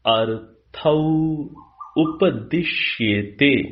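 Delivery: slow word-by-word Sanskrit reading with long pauses; slow, segmented Sanskrit recitation; sandhi broken apart. Shown as a demonstration. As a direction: read each Sanskrit word separately, drawn out for learners. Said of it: "तो मैं आपकी अनुकूलता के लिए संधि तोड़कर".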